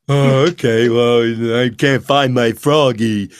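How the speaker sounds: a lightly voiced slow, dopey cat; dumb cat voice. Shown as a demonstration. Voice actor demoing the dumb cat voice he gave the director. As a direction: play Big the Cat a little drunk and dumb. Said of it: "I start talking like this".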